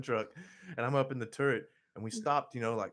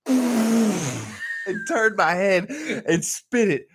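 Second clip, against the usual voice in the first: laughing; 8 semitones higher, 10 dB louder, much slower than usual. Real, not acted. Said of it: "I get some, like, laughter".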